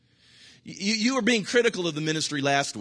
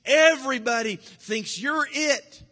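mock flattery; 10 semitones higher, 4 dB louder, slower than usual. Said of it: "greatest thing since chipped beef".

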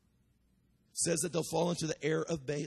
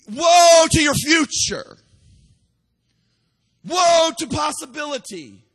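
high, loud shouted woes, slow; crying out woe; 10 semitones higher, 16 dB louder, slower than usual. Retold or imitated. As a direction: cry each woe out high and hard. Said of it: "That's what he's crying out".

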